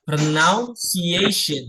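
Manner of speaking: pronunciation stretched very slowly; slow model word. Shown as a demonstration. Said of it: "Sekali lagi".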